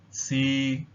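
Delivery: "si" held level at mid pitch, Cantonese tone three. Shown as a demonstration. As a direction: keep "si" steady in the middle of the voice. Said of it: "the mid-level tone".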